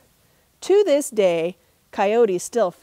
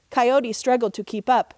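plain reading voice

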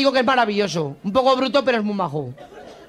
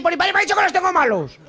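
higher, pleading recruit's whine; a naive recruit protesting, higher; insistent recruit; 7 semitones higher than usual. Acted. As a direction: push it up high like a stubborn lad. Recruit insists a third time.

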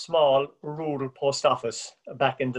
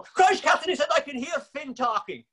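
much higher, astonished outburst; amazed exclamation; 12 semitones higher than usual. Retold or imitated. Danny calling to Catherine, astonished and much higher. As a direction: gasp in wonder at the voice.

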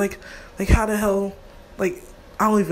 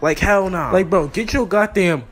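ordinary delivery

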